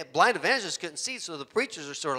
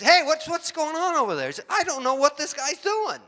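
higher and louder, getting worked up; agitated blind man; 11 semitones higher, 3 dB louder than usual. Retold or imitated. Blind evangelist alarmed by the prank driver, agitated and higher. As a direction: sound alarmed at the road. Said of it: "And the blind evangelist".

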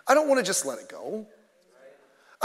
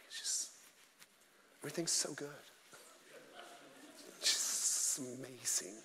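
breathy, hushed, syrupy mock-pastor voice, much softer; 7 semitones lower, 17 dB quieter than usual. Preacher mocking how trendy pastors talk, hushed sugary voice. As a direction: lampoon the soft-spoken trendy pastor, barely above a whisper. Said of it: "they change their voices".

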